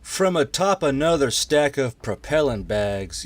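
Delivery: ordinary delivery, nothing marked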